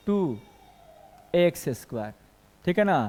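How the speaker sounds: ordinary talk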